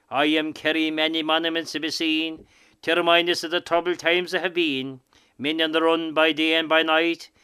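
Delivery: ordinary talk, nothing marked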